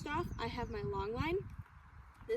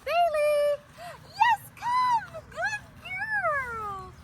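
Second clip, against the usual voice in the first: excited recall call; 12 dB louder, much slower than usual. Real, not acted. Calling the dog to come.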